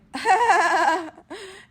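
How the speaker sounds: loud, high forced laugh; fake laugh. Acting amused for the date to hide panic, fake laugh. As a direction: burst into an over-loud put-on laugh. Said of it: "time to be an actor".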